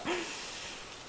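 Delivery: high breathy nervous laugh; nervous breathy giggle. Real, not acted.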